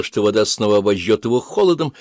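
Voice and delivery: plain reading voice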